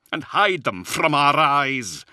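high, loud, drawn-out charge; fierce accusation. A narrator's reading. Luther brandishing the book at Zahariel, fiercely accusing. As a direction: stretch the words out with bitter force.

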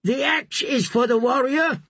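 high, distant shout from the crowd; shouted back. Acted. A man in the crowd shouting an answer to the priest. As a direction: call the answer back across the shore. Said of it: "Rush shouted back".

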